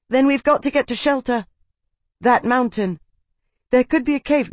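high, pressing voice; urgent suggestion. A narrator's reading. Slave urges Tavi to find shelter, high urgent voice. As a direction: urge them toward safety, pitched high.

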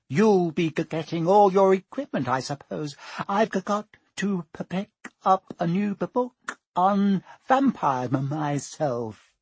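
stuttering, jittery teacher; nervous stammer. A narrator's reading. Quirrell stammers.

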